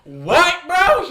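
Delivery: loud, high-pitched disbelief; shocked outburst. Retold or imitated. Told in the car about the cop, shocked yell. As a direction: blurt it out in retold shock.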